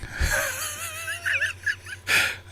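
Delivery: soft, squeaky giggle close to crying; high-pitched laugh. Real, not acted. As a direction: laugh helplessly, high and quiet. Amused by crying face filter.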